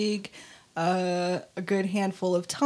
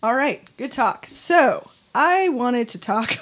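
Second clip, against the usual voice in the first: ordinary delivery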